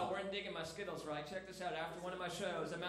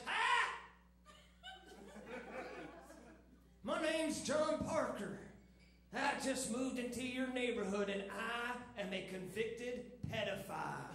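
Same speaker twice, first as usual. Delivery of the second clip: cheery neighbor; slower than usual. Retold or imitated. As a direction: play the overly friendly new guy at the door. New neighbor introduces himself.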